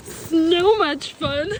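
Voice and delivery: slow, loud and stretched; drawn-out gleeful. Real, not acted. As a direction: savour every word, delighted.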